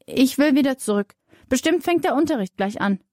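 usual speaking voice

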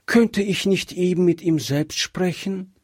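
put-on high, childlike pleading question; little girl. A narrator's reading. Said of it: "fragte Gretchen". (bittende hohe Kinderstimme)

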